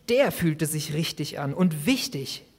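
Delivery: ordinary talk, nothing marked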